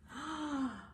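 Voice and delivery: sharp dramatic gasp; surprised gasp. Acted. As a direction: gasp for the kids. Reacting to the book.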